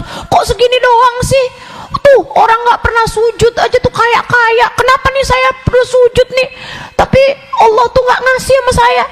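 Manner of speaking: high-pitched mock grumbling; whiny complaining. Ungrateful poor person griping at God, mock whiny. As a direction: imitate a griping ingrate, hammed-up. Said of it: "Kan keluh kesah mulu tuh".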